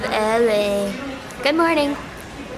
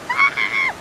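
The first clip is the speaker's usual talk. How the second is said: very high happy shriek; delighted squeal. Real, not acted. Excited squeal at the pier. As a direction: let out a thrilled squeal.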